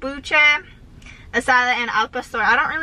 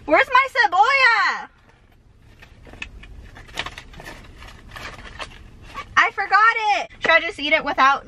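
high dismayed; 8 semitones higher than usual. Real, not acted. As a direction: sound dismayed at the missing onion. Searching for missing onion.

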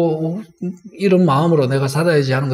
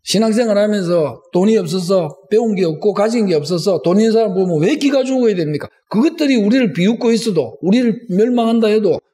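higher-pitched aggrieved protest to God; 따지는 기도; 5 semitones higher than usual. Acted. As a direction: plead the case indignantly, question after question. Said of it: "제가 앞에 말한 이것을 따지는 겁니다". (높은 목소리로 억울함을 따져 묻듯)

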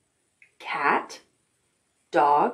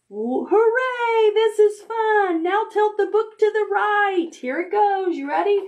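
excited cheer; 14 semitones higher than usual. Acted. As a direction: cheer like it's the best ride ever. Excited reading to the child.

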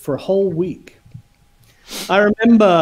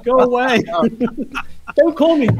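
laughing mock shout; 5 semitones higher than usual. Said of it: "unless it wasn't Chick".

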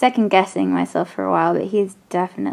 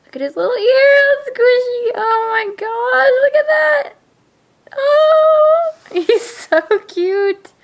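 much higher, louder gushing squeal; delighted squeal; 8 dB louder than usual. Real, not acted. Cuddling her new baby doll, high delighted squeal. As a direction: squeal like meeting a newborn.

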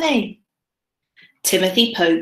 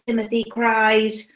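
plain reading voice

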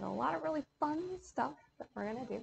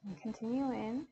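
calm yoga teacher counting out a box breath; guided meditation. Acted. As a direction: talk the class gently through each breath.

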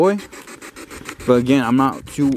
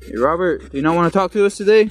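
calling out to a ghost; 5 semitones higher than usual. Real, not acted.

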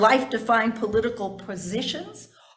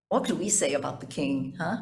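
usual speaking voice

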